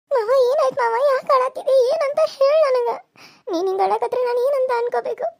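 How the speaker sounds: high, crying pleas to the weeping uncle; tearful worry. Acted. Niece to weeping uncle, high crying pleas. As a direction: beg him through tears to speak.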